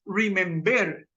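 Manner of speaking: remember stressed on the last syllable, remem-BER; wrong stress demo. Shown as a demonstration. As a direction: slowly demonstrate remember with the accent on the end. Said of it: "La acentuación no está en ver".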